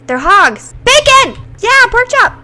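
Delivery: high, loud gleeful cries; excited yelling. Real, not acted. Starving player spotting hogs, yelling with excitement. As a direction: cheer at the sight of food.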